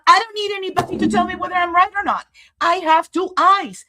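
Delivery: indignant, rushed declaration; defiant outburst. Real, not acted. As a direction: snap back that nobody needs to tell you. Defiant outburst after self-doubt.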